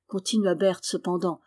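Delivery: plain reading voice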